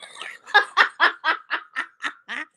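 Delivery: long high cackle; high, rolling belly laugh; real laughing fit. Real, not acted. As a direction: crack up helplessly at your own joke. Cracks up at own joke.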